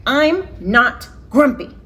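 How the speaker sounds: high, loud, slow huffy denial; grumpy denial. Acted. Jim huffing at Norman, grumpy and loud. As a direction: insist you're not grumpy.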